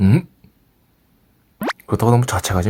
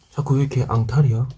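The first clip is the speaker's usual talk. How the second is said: usual speaking voice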